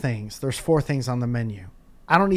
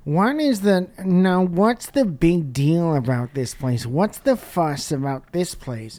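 high, slow, nasal nerd whine; nasally nerd voice; 4 semitones higher, 5 dB louder, slower than usual. Retold or imitated. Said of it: "The most nasally nerd voice".

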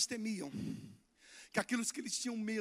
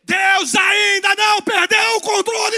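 slow, much higher and louder shouted preaching; full preaching shout; 12 semitones higher, 20 dB louder, slower than usual. Real, not acted. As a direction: hammer it out at the top of the voice. Clímax da pregação. (pregação gritada, lenta e muito alta)

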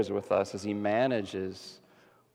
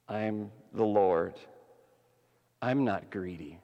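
gentle generous God; 4 semitones lower, 4 dB quieter than usual. Acted. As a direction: share it lovingly, tenderly. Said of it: "And he says".

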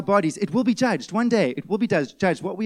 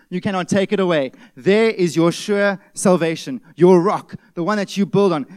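louder, punchy pulpit proclamation; forceful preaching; 6 dB louder than usual. Acted. Pastor proclaiming salvation to the church, forceful preaching. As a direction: drive each phrase home from the pulpit.

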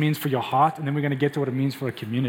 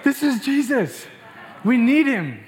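half-shouted, raised plea to himself; screaming at my soul; 9 semitones higher, slower than usual. Retold or imitated. Pastor shouting at his own soul, raised urgent voice. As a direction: push it out at your own soul. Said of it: "I'm screaming at my own soul".

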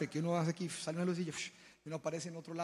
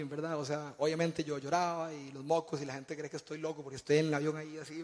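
ordinary delivery

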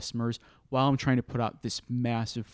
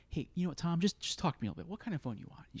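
soft, calming voice, much quieter; soothing; 4 semitones higher, 8 dB quieter, faster than usual. Retold or imitated. Store worker quietly soothing a frustrated customer, calm and soft. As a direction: talk him down gently, quiet and easy.

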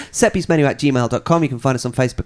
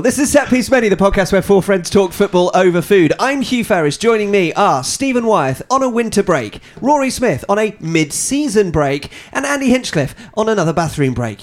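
higher, projected presenter voice; podcast intro; 6 semitones higher than usual. Real, not acted. Host introducing the podcast and co-hosts, presenter voice. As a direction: announce the show and line-up like a presenter.

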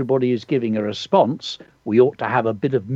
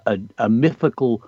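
ordinary talk

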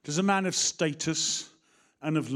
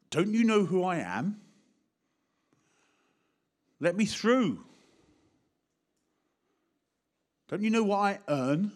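mock arrogance; 6 semitones higher, 3 dB louder than usual. Mock proud self-important voice.

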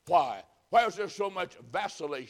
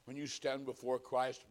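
ordinary talk

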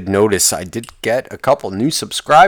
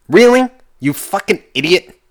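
higher, louder exasperated rant; louder, higher, slowing into a fed-up outburst; angry rant; 9 semitones higher, 6 dB louder than usual. Real, not acted. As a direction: snap at them in disbelief. Ranting at people who complain.

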